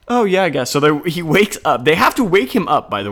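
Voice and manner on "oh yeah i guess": offhand, unbothered reply, higher and louder; mock nonchalant Jesus; casual shrug. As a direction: toss it off like it barely matters. Sleepy Jesus answer voiced.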